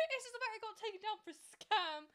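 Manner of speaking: giggling through the sentence; laughing. Real, not acted. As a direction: let the laugh spill into it. Amused retelling of takedown.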